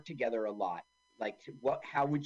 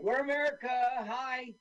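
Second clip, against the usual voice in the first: goofy American soldier; 10 semitones higher than usual. Retold or imitated. Mocking dubbed soldiers.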